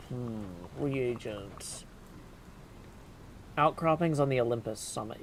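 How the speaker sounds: normal talking voice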